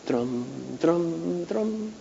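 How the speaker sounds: hushed imitated harp strums; soft harp strum. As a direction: breathe out a gentle strumming sound. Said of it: "strumming on your harp".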